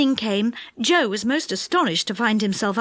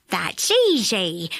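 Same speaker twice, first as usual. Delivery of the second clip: witch voice; 3 semitones lower, slower than usual. Acted. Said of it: "said the witch".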